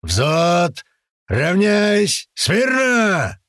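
high full-lunged parade command; shouting drill sergeant. A narrator's reading. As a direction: bellow it across the parade ground. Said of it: "сейчас проорёт какую-то команду".

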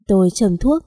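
plain reading voice

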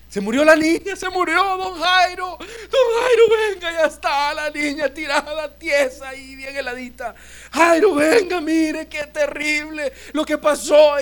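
high, loud crying wail with breaking sobs; wailing mourners. Acted. Mourners at the house crying out to Jairo, sobbing wail. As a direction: wail the news at Jairo, half shouting through tears.

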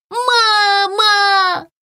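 протяжный высокий крик волчонка; зови маму на помощь, высоко и протяжно; испуганный крик. A narrator's reading. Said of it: "закричал испуганный волчонок". (испуганно кричит, зовёт маму)

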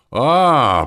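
high, loud drawn-out "А". A narrator's reading. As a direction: stretch the realization out loudly. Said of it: "протянул эльф". (протяжно, нараспев)

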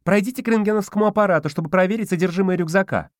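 high, fast, yappy; yapping guard. A narrator's reading. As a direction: yap it like a chihuahua. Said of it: "Затявкала".

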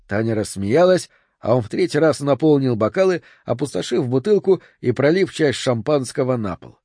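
ordinary delivery, nothing marked

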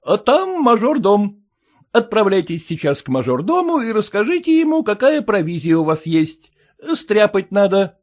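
повыше, хозяин распоряжается; бойко командует прислугой; голос Самоплясова. Acted. (бодрый голос хозяина)

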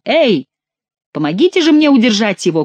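calling out. A narrator's reading. King needs help restraining his favourite, calling out. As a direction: call urgently for a hand. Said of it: "крикнул Генрих".

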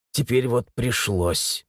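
ordinary delivery, nothing marked

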